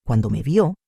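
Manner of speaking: ordinary delivery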